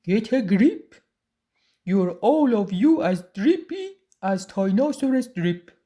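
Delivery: loud, put-on father's rebuke; gruff storybook telling-off; blustering dad. A narrator's reading. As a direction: bark it at the cowering family. Dad rebuking his trembling family.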